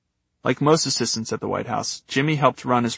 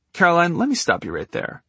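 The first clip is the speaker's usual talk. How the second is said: higher, lightly gravelly boss interrupting; 6 semitones higher than usual. Retold or imitated. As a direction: cut her off with a gravelly edge. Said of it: "Sean, in his gravelly voice".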